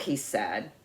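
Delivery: ordinary delivery, nothing marked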